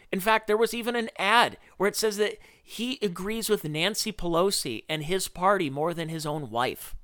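ordinary delivery

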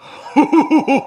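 loud, high, long hooting cackle; put-on signature laugh. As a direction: let out a big theatrical laugh. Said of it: "I love this laugh".

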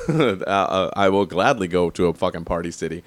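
usual speaking voice

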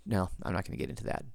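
whispered aside to self, quickly; muttered aside. Real, not acted. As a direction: drop to a breathy under-the-breath aside. Self-interrupting aside to listeners.

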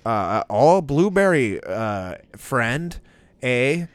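high, loud mocking Canadian accent. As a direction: ham up a smug Canadian clerk with eh. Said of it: "this is the dude in fucking Tim Hortons".

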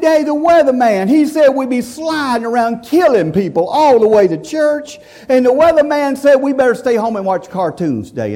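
put-on high, eager little kid arguing; child's voice. A child tells Daddy the weatherman said stay home, high eager voice. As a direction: plead like a child inventing excuses. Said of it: "I said".

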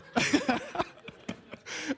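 loud high cackle of real laughter; high shrieking laugh. Real, not acted. Preacher roaring with laughter at the gas station story. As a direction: lose it completely. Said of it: "busting out laughing".

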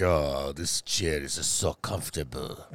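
put-on very low, slow dragon with a Russian accent; lazy put-on Russian accent, pitched right down; mock Russian accent. Dragon lounging in a chair, mock Russian accent. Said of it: "I think the dragon was Russian".

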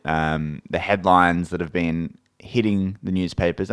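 ordinary delivery

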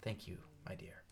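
soft in-character whisper; whispered thanks. Acted. Game master as a character thanking someone, whispered. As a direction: whisper it low and gently.

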